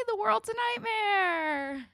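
high, slow, half-laughing wail; mock wail. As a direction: moan it like a hammed-up breakdown. Ironic despair after "yay.

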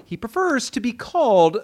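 mock newscast sign-off; news anchor voice. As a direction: hand it back like an anchor.

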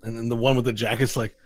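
ordinary talk

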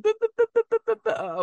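high, loud taunting buh buh buh; mocking Mets fans. Mets fans texting him when they're up, squealing high. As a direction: squeal it like a gloating rival fan. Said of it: "They'll be texting".

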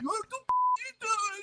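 very high, startled protest; the kid whose cupcake was crushed cries out; shocked kid. Retold or imitated. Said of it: "I'm like".

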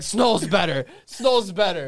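mimicking a friend. Quoting a friend who dismisses the singer, loud mimicry. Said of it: "He's like".

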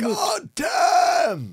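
high yelled curse; father's shout. Retold or imitated. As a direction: bellow it like a dad woken up. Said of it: "That's what my father used to say".

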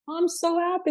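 high mock-mom gush; overjoyed mother. Mother hears he asked the girl to marry, overjoyed. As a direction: play the mother thrilled, pitched high. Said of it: "Mother's like".

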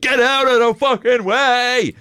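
high, slow, hammed-up yell at pedestrians; mock scream. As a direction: bellow it like a runaway cyclist. Screaming down hill on fridge bike.

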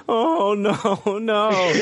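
high-pitched gasp of dismay; tearful dismay. Retold or imitated. People in the theater as the brachiosaurus dies, mock-tearful dismay. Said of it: "tearing up in the movie theater".

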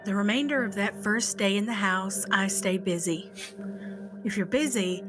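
ordinary delivery, nothing marked

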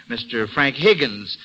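ordinary talk

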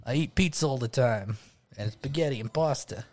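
hammed-up New York Italian pizza guy; chatty put-on New York accent; mock New York accent. Co-host improvising a pizza guy, mock New York accent. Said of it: "I can keep doing it if you want".

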